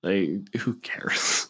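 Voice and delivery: breathy unvoiced throwaway whisper; muttered aside, barely voiced. Real, not acted. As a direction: toss it off under your breath. Dismissive aside mid-argument.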